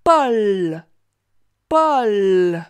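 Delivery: "Paul" loud, the final L held long; drawn-out final L. Shown as a demonstration. Teacher repeating Paul so the child hears L, L lengthened. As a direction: stretch out the L in each Paul. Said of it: "Tu entends bien une seule fois le son L".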